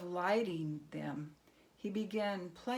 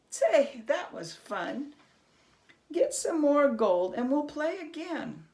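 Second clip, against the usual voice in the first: gleeful gloating; 9 semitones higher, 8 dB louder than usual. Acted. Herschel gloats over winnings.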